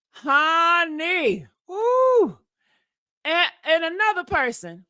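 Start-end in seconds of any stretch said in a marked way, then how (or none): 0.1-1.5 "honey" stretched long, higher, then falling; drawn-out honey. Real, not acted. Reacting to the cahoots claim, drawn-out "honey". 1.7-2.4 long high-pitched "woo". Real, not acted. Exclaiming after the honeys, high woo.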